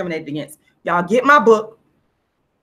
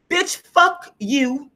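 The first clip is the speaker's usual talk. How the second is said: slow, stretched, higher mock disdain; drawn-out contempt; 5 semitones higher, much slower than usual. Voicing her inner attitude toward the relatives, slow stretched disdain. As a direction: drag each word out with scorn. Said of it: "my attitude says".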